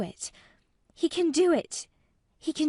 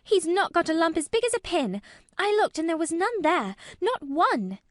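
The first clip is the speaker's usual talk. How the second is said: put-on high, loud girl's protest; Mary, fiercely indignant; 9 semitones higher, 5 dB louder than usual. A narrator's reading. Girl defending the boy to the gardener, indignant. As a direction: rattle it out, almost shouting. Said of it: "almost shouting up the wall in her fierce indignation".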